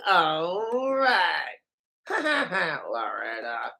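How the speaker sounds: medley of cartoon catchphrases and silly noises; Family Guy characters. Asked for other voices, hammed-up Family Guy mannerisms. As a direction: jump between goofy cartoon voices and chuckles. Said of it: "those are Peter's mannerisms".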